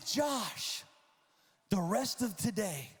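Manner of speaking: rally him like a coach; fired-up coach. Retold or imitated. Coach pumping up Josh to attack the ball, fired-up pep talk.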